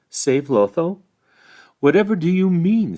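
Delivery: pitched high in disbelief; astonished. Acted. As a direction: Pippin can't believe what he hears. Pippin's astonished question.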